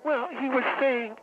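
normal talking voice

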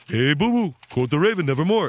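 lightly voiced Yogi Bear reading the poem; a hint of a jolly cartoon bear. Host mocks his son's reading as Yogi Bear, jolly cartoon voice. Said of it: "you're reading it like Yogi Bear".

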